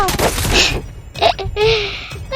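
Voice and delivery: a sudden high laugh at the screen; high startled laughter; burst of laughter. Real, not acted. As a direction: laugh it off in surprise. Startled in the game.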